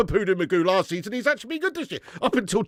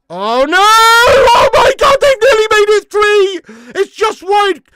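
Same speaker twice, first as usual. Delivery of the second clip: anguished shout; 14 semitones higher, 14 dB louder, slower than usual. Real, not acted. Near miss against his team.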